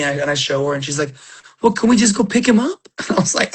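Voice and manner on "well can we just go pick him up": put-on higher, pleading child voice; lightly acted child's plea; imitated little daughter. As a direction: ask like a hopeful little kid. Young daughter's plea retold.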